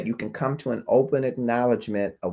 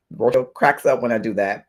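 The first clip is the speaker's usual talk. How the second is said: ordinary talk, nothing marked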